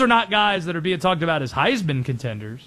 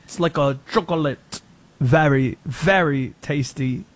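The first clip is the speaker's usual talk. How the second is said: mock French accent; 4 semitones lower than usual. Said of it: "Be like".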